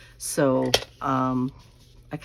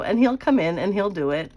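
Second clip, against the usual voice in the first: ordinary talk, nothing marked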